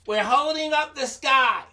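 lightly voiced animals calling their answer; straining animals. Mosquito and elephant answering lion, calling out.

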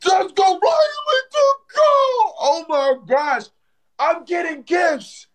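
very high, drawn-out victory yell; high-pitched jubilant holler; ecstatic hype shout. Real, not acted. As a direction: stretch it out high like a winning goal call. Celebrates gifts coming in.

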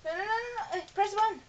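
high panicked no's; being chased. Real, not acted. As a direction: panic as they close in.

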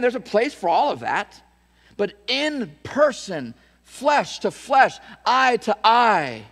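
loud, high, slowing to a hammer at the end; full-force preaching. Acted. Pastor preaching with full force to his church. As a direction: preach it, word by word.

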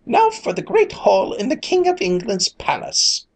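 high, excited fairy cry; fairies crying out. A narrator's reading. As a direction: call out gleefully as a fairy. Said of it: "straightened their caps and cried".

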